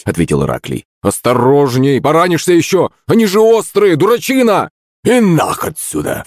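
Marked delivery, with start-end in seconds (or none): 1.0-4.7 high-pitched urgent warning; alarmed warning. A narrator's reading. Alarmed warning to drunk friend. 5.0-6.2 put-on high, strained drunken outburst; drunken cry. A narrator's reading. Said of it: "вскрикнул Захарыч".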